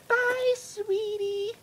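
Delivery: very high, drawn-out sweet-mother voice; mock sugary motherly farewell; doting mom. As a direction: coo it slowly in falsetto. Mom bids her son goodbye.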